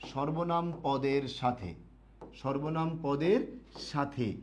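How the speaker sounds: slow, word by word for students to write; slow dictation. Shown as a demonstration. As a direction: dictate it slowly so they can copy. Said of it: "একটু লিখবেন সবাই". (ধীরে ধীরে শ্রুতলিখন)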